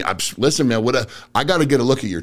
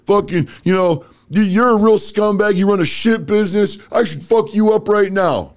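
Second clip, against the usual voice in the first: put-on high, loud drunken rant; drunk angry customer; 8 semitones higher, 3 dB louder than usual. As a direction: rage at the dealer who sold him junk. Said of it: "Guy's hammered".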